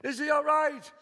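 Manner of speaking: frantic high shout from upstairs; mother yelling in a panic; mother screaming. Acted. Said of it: "I heard my mother screaming".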